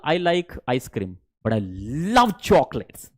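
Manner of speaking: "love" drawn out long at the end; stretched love. Shown as a demonstration. Teacher stretching "love" in an example. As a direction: drag out "love" in "love chocolates". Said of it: "लव को आपने खींचा".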